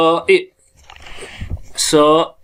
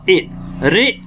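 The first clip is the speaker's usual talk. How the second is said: usual speaking voice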